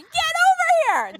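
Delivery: high, slow scream. Retold or imitated. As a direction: yell it high and drawn out.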